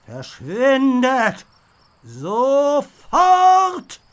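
a haunted knight's armour bellows at intruders to vanish, booming; ghost knight shouting. A narrator's reading. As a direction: roar them out of the castle like a haunted suit of armour. Ghost knight orders them out.

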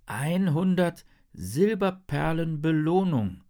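high, slow poster reading; Henry, reading aloud. A narrator's reading. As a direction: spell out the reward deliberately. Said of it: "liest er". (langsam und staunend vorgelesen)

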